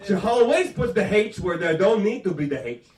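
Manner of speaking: put-on loud, thick French Canadian accent. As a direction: mimic mother's French Canadian English, misplacing the H. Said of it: "French Canadian accent".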